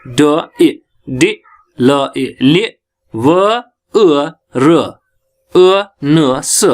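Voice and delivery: each sound of ड ए डे said separately and slowly; slow phonics demo, single sounds then blended. Shown as a demonstration. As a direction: spell out ड, ए, डे, ल, ए, ले sound by sound. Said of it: "देखो, मैं sounds बताऊंगा".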